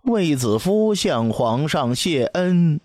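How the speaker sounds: drawn-out, high-pitched court announcement; high-voiced proclamation. A narrator's reading. Attendant announces an audience before the emperor, high ceremonial call. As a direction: intone it slowly and high, like a herald. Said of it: "高声念道".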